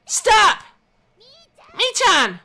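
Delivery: high, very loud shout. A narrator's reading. Rena shouting at Mion. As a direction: shout to stop her. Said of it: "Rena ... shouted at me".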